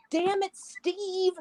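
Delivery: high, drawn-out cry of self-reproach; mock exasperated cry. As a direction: stretch it out like kicking yourself. Character berates himself on stairs.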